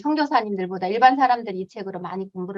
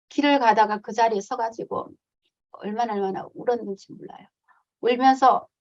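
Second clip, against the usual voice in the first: normal talking voice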